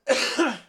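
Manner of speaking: one sharp real cough. Real, not acted. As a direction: cough once away from the mic. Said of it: "So I'm gonna cough".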